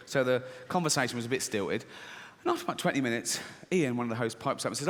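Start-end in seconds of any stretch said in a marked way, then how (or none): none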